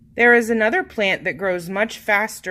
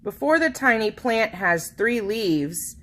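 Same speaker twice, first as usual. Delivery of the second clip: ordinary delivery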